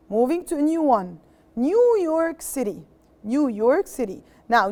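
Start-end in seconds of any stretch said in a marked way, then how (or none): none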